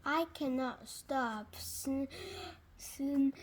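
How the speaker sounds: ordinary delivery, nothing marked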